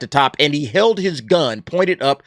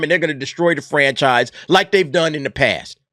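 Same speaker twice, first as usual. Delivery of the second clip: ordinary talk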